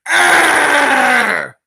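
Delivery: loud, high mock-furious roar; hammed-up animal snarl, held long; angry roar. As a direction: roar like an enraged Wolverine. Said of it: "He's really angry about that wiener".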